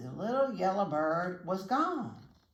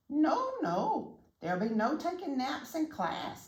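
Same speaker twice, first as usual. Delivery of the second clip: bird declaring; 8 semitones higher than usual. A narrator's reading. Bird teacher refusing the dog a nap, high and firm. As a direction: firmly lay down the class rule in a bird voice. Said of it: "declared the bird".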